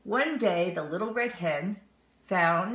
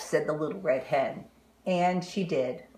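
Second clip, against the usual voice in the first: ordinary delivery, nothing marked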